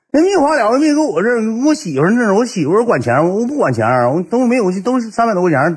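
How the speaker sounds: high-pitched mock protest; flustered excuses. Friend dodging a loan request, flustered and high.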